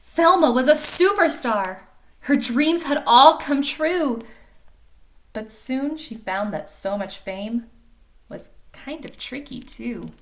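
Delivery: lively storybook reading voice; animated picture-book narration; reading to children. A narrator's reading. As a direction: read it like story time for little kids. Reads picture book aloud.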